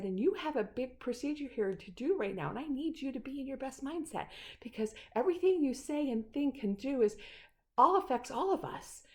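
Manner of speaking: insistent appeal. Retold or imitated. Patient to Nick before a big procedure, insistent. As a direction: drive each point home, retelling it. Urges Nick to fix his mindset.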